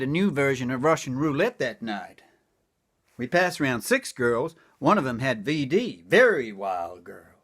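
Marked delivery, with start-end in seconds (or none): 6.1-7.4 deep, slow leering catchphrase; low drawn-out refrain. Acted. Comic repeating his refrain low and slow.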